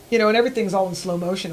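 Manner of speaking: normal talking voice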